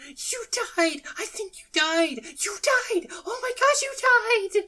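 frantic shrieking panic; hysterical laugh. Acted. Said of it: "She's just like".

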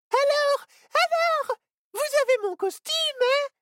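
put-on very high bouncy child voice; little girl demanding her costume; excited little girl. A narrator's reading. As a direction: hop about eagerly. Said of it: "s'excita la fillette".

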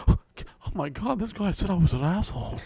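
hushed scandalised gasp; imitating his MySpace self over an insult; mock shock.

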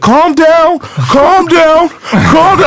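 yelled calm down. Retold or imitated. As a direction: shout it over and over at him. Bouncers yelling at him.

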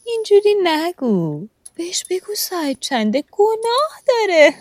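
teasing the dying man with mock concern; mock pity. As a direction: fake pity with a laugh.

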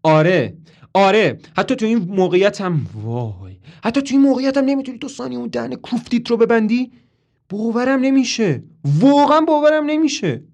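high, loud exploding rant; furious. A narrator's reading. Toby losing it and yelling at Marilyn, furious. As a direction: lose control and yell. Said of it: "با عصبانیت میگه".

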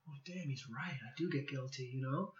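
telling a podcast host how the mentor's words landed, mumbled softly; hushed realization. Retold or imitated. A touch of stunned hush. Retelling his own reaction.

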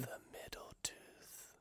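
slow, hushed whisper; slow mock-ASMR whisper. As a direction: whisper it right into the microphone. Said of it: "Please don't do A S M R".

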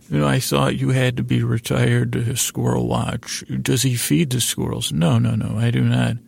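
normal talking voice

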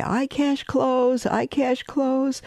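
high, slow street cry; Brooklyn peddler's call. Retold or imitated. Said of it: "peddlers walking".

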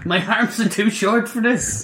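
high, slow whiny dinosaur voice; T-Rex voice. Acted. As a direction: whine it like a tiny-armed T-Rex.

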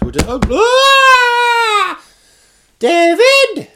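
long, very high comic scream, then shrieked name; mock scream. Imitating their shock after typing it in, mock screaming. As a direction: scream in mock horror, drawn out and piercing. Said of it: "Like that, you know?".

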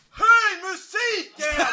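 thin high-pitched heckle, drawn out; imitated heckler's yell. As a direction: drag out a squeezed yell from the crowd. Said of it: "jag hör ju hur någon skriker".

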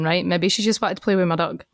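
ordinary talk, nothing marked

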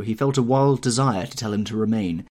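usual speaking voice

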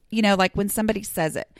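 ordinary talk

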